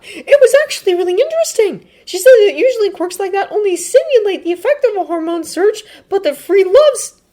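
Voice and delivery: very high, loud, eager voice; Midoriya perking up. Acted. Midoriya rattling off quirk facts to friends, eager. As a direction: gush with high-pitched interest. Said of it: "He perks up".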